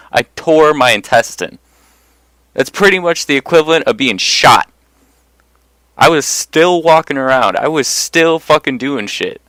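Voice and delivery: usual speaking voice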